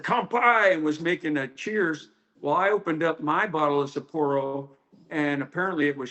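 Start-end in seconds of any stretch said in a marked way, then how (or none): 0.0-0.7 higher-pitched call of a toast; retold toast. Sensei making a cheers at the team table, retold toast. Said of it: "opened up a bottle of Sapporo and said".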